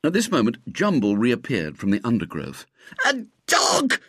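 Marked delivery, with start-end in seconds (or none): 3.1-4.0 high, horrified gasp. A narrator's reading. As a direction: gasp it out in outrage. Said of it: "gasped the young man".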